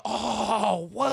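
put-on high, loud shocked gasp of kids. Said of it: "They was like".

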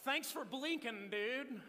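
high, loud, drawn-out mock retort; mock scoff. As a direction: throw it out loudly like a heckler. Mimics older married men's retort.

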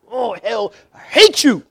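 re-enacted outburst, jumping high on the last words; upset. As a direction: act out being upset at hell. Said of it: "I'm getting upset".

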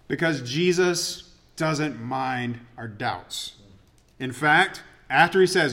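usual speaking voice

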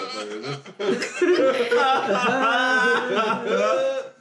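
awkward high giggle; a long, uneasy, awkward laugh; nervous laugh. Real, not acted. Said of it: "that's a really awkward laugh".

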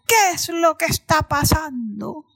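loud, commanding storybook question; lion king demanding. Acted. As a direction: demand it over the whole jungle. Lion demands answers after roaring. (rey león exigiendo, voz fuerte)

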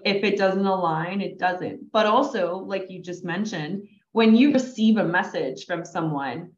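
usual speaking voice

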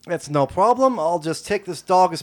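high, loud urchin voice fawning over the dog; cheeky urchin sweet-talking a giant dog; street kid voice. Acted. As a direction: coo at the big dog like a scrappy street kid.

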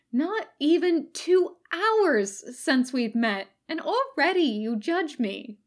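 high, slow mock-wounded sigh; sighing Katsuko. A narrator's reading. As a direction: sigh it out, playfully hurt. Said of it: "Katsuko sighed".

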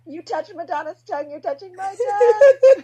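high, loud, laughing squeal; star-struck shriek. Retold or imitated. Singer to doctor who touched [name]'s tongue, shrieking. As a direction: squeal it out in disbelief. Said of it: "I was like".

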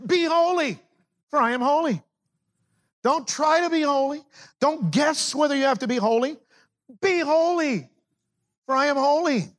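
high, ringing preacher's cry; preaching at full force. Acted. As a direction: proclaim it like a command from the pulpit. Preaching the command.

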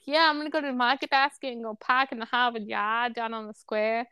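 put-on r-dropping Boston accent; mock Boston townie accent. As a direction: imitate a Boston local, dropping the r's. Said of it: "I don't know how the Boston accent is hot".

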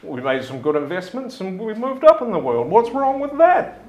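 high, loud, self-satisfied rich father; pompous father. Acted. Father lowering his newspaper to defend their wealth, pompous and high. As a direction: puff up and defend the new money. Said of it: "looks at you and says".

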